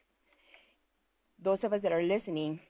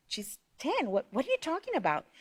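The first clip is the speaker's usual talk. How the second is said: shocked grandma; 6 semitones higher than usual. Retold or imitated.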